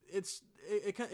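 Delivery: normal talking voice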